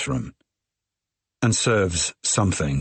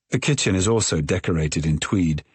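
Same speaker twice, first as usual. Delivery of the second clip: plain reading voice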